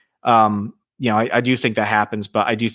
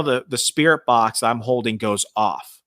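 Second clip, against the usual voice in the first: ordinary delivery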